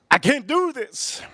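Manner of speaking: high, drawn-out whine; acted high-pitched despair; mock breakdown. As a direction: whine it like you're about to give up. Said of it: "I almost broke down".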